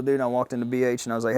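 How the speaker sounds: usual speaking voice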